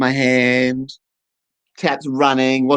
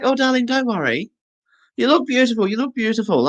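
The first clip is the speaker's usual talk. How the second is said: high cooing beautician voice; 8 semitones higher than usual. Retold or imitated. Beautician flattering her client, high mock coo.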